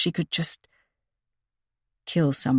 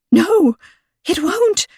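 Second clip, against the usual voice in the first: panicked denial; 13 semitones higher, 6 dB louder, slower than usual. A narrator's reading. Panicked woman contradicts Callie.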